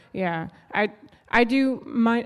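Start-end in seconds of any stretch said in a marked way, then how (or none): none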